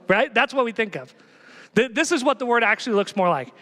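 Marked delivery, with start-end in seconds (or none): none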